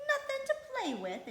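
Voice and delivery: shocked disbelief, thrown up high; astonished exclaim. A narrator's reading. Martha astonished at Mary, high and loud. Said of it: "exclaimed Martha".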